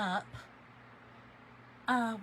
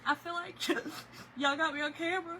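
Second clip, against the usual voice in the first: higher, half-laughing half-crying; laughing while crying; 4 semitones higher than usual. Retold or imitated. Retelling the cashier mix-up, laughing through tears. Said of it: "And I said".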